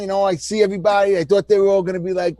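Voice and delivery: ordinary talk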